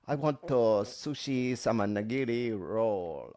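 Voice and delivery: slow, deliberately bad Japanese accent. Doing it badly for co-host, mock Japanese accent. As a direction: drag it out in a clumsy Japanese accent. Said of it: "What about if I do it really badly?".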